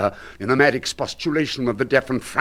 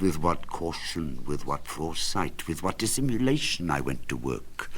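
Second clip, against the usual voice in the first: sly hushed boast; 6 semitones lower, 6 dB quieter than usual. Acted. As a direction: confide your cleverness. Sly boasting of his caution.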